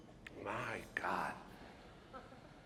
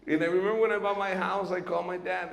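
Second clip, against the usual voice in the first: normal talking voice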